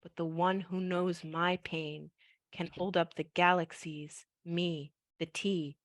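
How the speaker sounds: soft, slow poetry recital; poem reading. Acted. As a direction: read it gently and slowly. Reads her poem aloud.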